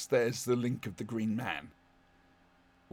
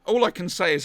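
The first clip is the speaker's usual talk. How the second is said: ordinary delivery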